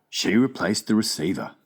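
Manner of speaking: plain reading voice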